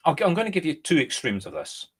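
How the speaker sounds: ordinary talk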